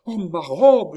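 high, loud wail of dismay; anguished cry. A narrator's reading. Countess remembering the lost dress, crying out. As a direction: cry out as disaster comes back to mind. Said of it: "s'écria madame du Barry".

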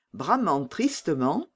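ordinary delivery

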